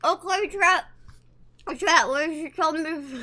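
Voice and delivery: talking through a stuffed mouth; muffled words with food in the mouth; mouth full. Shown as a demonstration. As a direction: try to talk round a big mouthful. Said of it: "shove this all in my mouth and try and stream and talk".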